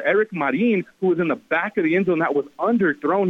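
ordinary talk, nothing marked

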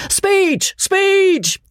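very high, drawn-out shouts; shrill clamor. A narrator's reading. Party takes up the call for a speech, shrill shouting. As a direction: take up the chant shrilly. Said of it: "shrilly, hoarsely".

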